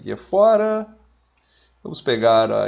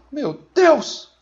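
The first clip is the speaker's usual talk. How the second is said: high, loud exclamation; mock horror; 13 semitones higher, 5 dB louder than usual. Officer who has just lost a soldier, loud mock horror. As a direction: cry out in theatrical shock.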